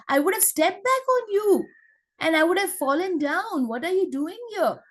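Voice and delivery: high, mock rude shout from a big monkey. Monkey scolding the squirrel in his way, high mock shout. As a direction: bark at the little squirrel like a rude brute. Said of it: "if he says that".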